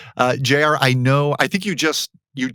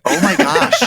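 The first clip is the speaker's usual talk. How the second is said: high-pitched, playful panicked exclamation; mock panic; 10 semitones higher, 5 dB louder than usual. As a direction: act out panicking, voice jumping up high. Retelling his own mock panic.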